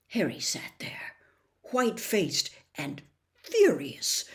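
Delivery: plain reading voice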